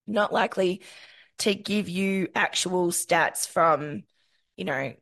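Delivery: usual speaking voice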